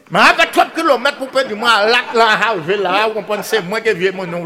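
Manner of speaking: ordinary delivery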